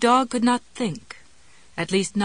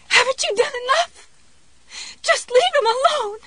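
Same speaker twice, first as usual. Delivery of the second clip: much higher, louder breaking cry; gaspy falsetto shriek; 7 dB louder than usual. Acted. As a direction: shriek until the voice cracks. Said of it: "broke ... into that upper register where shrieks became gaspy falsetto whispers".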